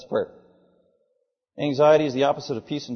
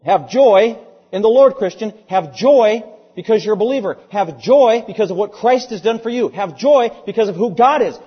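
loud, raised preaching with punched repeated phrases; emphatic preaching; 5 semitones higher, 7 dB louder than usual. Real, not acted. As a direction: exhort the congregation, hammering each "Have joy".

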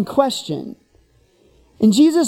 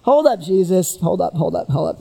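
flustered lawyer; 6 semitones lower than usual. Retold or imitated. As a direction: stall like a cornered lawyer. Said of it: "lawyer attempting to recover".

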